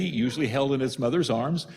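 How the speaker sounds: ordinary talk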